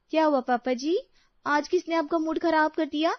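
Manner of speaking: put-on high, inquiring young-woman voice; concerned daughter-in-law. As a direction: ask father-in-law what upset him, pitched high.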